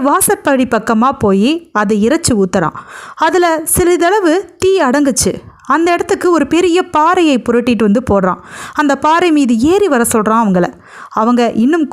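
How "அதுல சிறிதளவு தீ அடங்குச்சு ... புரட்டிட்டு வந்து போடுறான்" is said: ordinary delivery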